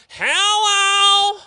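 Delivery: high, loud nasal "Hello!" shout; nasal yell. Retold or imitated. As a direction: belt it out nasally from the pews. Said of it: "in the most nasally voice, he'd yell out".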